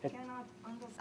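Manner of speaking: put-on French-accented whisper. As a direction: hush it, secretive. Secret phone call aside.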